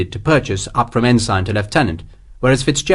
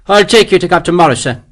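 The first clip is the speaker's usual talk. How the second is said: higher, louder anxious officer; nervous major; 7 semitones higher, 5 dB louder than usual. A narrator's reading. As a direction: hurry to help the general. Major voiced in story.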